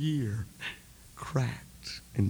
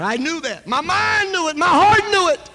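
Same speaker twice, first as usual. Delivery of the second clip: much higher and louder climb; rising preaching shout; 12 dB louder than usual. Real, not acted. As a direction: build to a shout. Preacher building climax.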